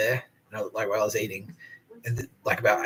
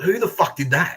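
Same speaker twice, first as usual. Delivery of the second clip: raised, louder re-enacted outrage; retold angry demand; 8 semitones higher, 7 dB louder than usual. Prisoner finding his cell trashed, retold angry demand. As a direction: re-enact demanding the culprit own up.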